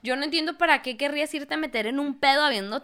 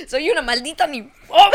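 laughing mock confession; 3 semitones higher, 8 dB louder than usual.

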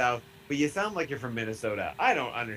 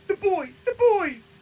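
put-on much higher, louder, hyped chant of the boys; 9 dB louder, slower than usual. Hyping up his friends to cohost, loud excited chant. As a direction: cheer the boys like a rallying cry. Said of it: "with a Z".

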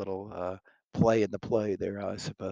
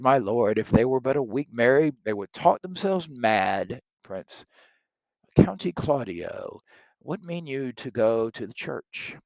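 reading Shakespeare aloud, louder and livelier than the commentary; dramatic play reading; 3 semitones higher, 7 dB louder than usual. Acted. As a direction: perform the verse for the class.

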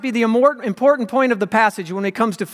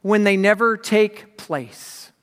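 slow emphatic statement; much slower than usual. Shown as a demonstration. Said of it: "slow emphatic maxim".

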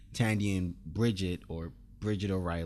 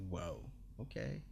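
much softer, mock-meek retreat; meek backing down; 4 semitones higher, 12 dB quieter than usual. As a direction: back off quietly, as if outranked. Acts the guy backing down.

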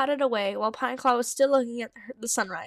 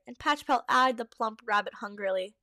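ordinary delivery, nothing marked

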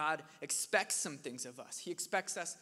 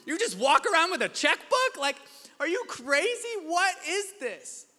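much higher and louder, laughing in disbelief; laughing exasperation; 11 semitones higher, 11 dB louder than usual. Retold or imitated. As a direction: blow up in laughing outrage. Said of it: "I was like".

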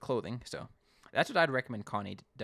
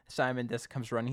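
usual speaking voice

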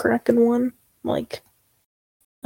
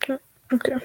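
ordinary talk

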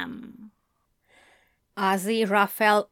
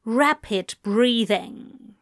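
ordinary delivery, nothing marked

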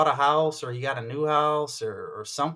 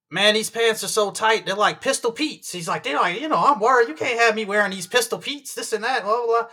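imitating the Southern guy; 9 semitones higher, 4 dB louder than usual.

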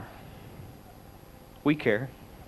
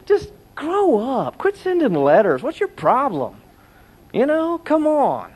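higher, slow, exasperated scolding; frank scolding; 11 semitones higher, 3 dB louder, slower than usual. Retold or imitated. Pastor scolding the letter writers, high slow exasperation. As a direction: tell them off bluntly, dragging it out. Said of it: "We need some frank talk like that".

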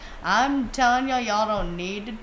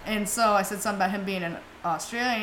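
normal talking voice

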